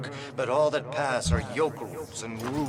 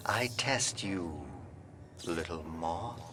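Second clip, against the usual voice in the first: hushed, softer purring threat; soft menace; 5 semitones lower, 8 dB quieter than usual. Acted. [name] teasing his guest, hushed and menacing. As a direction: lean in close and savor it.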